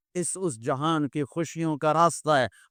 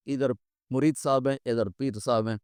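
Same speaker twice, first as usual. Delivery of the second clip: normal talking voice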